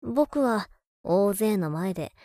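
normal talking voice

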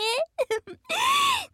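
long high shrieking laugh. Acted. Sister laughing at desperate brother, long high laugh. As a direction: laugh hard at his desperation.

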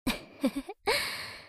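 high, soft titter; mischievous giggle. Acted. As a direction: giggle at your own prank. Delighted after pinching sister's nose. (いたずらっぽいクスクス笑い)

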